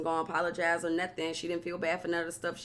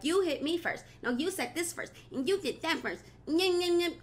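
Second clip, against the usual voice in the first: high mocking playground voice; childish taunt; 10 semitones higher than usual. Mocking the housewives' schoolyard quarrel in a high voice. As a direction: mimic squabbling kids, nyeh nyeh.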